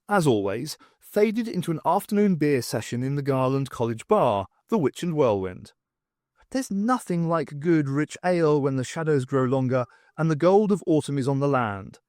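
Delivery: ordinary delivery, nothing marked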